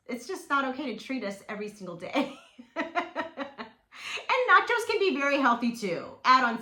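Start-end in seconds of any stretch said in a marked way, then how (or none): 2.6-3.7 light amused laugh; real chuckle. Real, not acted. Vlogger amused by her own treats warning, light chuckle. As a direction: chuckle at your own remark.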